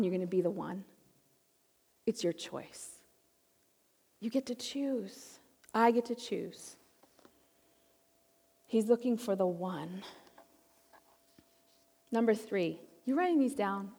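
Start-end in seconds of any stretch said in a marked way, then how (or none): 0.0-10.0 much softer, lower, intimate; quiet pulpit challenge; hushed appeal. Real, not acted. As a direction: pull the room in with a near-whisper. Preacher dropping to hushed appeal.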